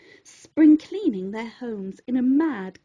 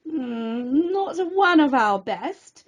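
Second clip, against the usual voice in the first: sniffy professor. A narrator's reading. Professor looks down her nose.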